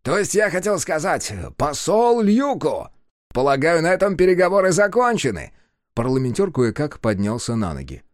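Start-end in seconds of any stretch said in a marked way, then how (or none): 0.0-5.5 mocking. Puma mockingly corrects himself.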